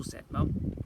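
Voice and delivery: ordinary delivery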